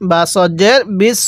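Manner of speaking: usual speaking voice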